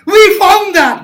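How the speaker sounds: loud high exclaim; triumphant cry. Real, not acted. Teacher celebrating with the class a solved step, loud and triumphant. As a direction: celebrate the find loudly.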